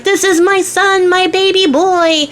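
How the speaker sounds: high, slow, stretched-out proclamation; acted proud dad, voice way up; overjoyed father. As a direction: proclaim it in hammed-up joy, drawing out each word. Dad joyfully claims his son.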